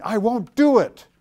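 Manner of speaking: high, loud balking "won't"; stubborn refusal. Retold or imitated. Pastor voicing his own stubborn refusal, high and loud. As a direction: plant your feet and refuse. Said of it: "even if I'm going".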